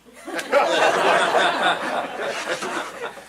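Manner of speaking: big unvoiced laugh; loud airy laughter; loud breathy laugh. Real, not acted. Audience laughing at the line.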